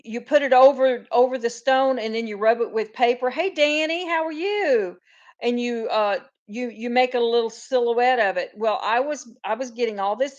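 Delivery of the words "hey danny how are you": high, cheery hello to a viewer; bright greeting. Real, not acted. Greeting Danny as she joins the live, cheerfully. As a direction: sing out a welcome.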